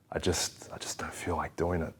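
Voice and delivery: very soft, half-whispered reluctance; hushed, imitated excuse to oneself; reluctant inner thought. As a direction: trail into a near-whisper, as if thinking it. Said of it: "You're thinking".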